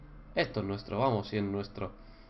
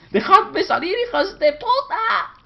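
very high, loud laughing scream; frantic shout; 9 dB louder than usual. Real, not acted. Trapped player screaming at the monsters, half laughing. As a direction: yell to be let out.

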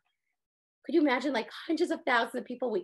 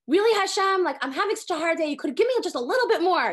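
exasperated; 4 semitones higher, 4 dB louder than usual. Retold or imitated. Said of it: "I do that all the time".

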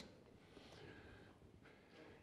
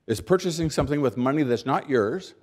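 ordinary talk

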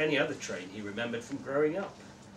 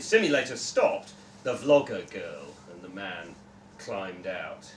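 ordinary delivery

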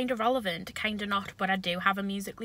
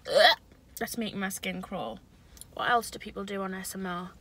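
ordinary talk

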